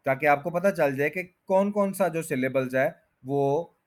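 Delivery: ordinary delivery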